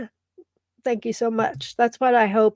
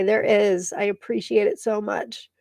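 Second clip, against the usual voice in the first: ordinary talk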